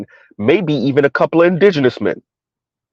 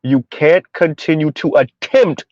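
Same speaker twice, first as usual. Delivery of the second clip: normal talking voice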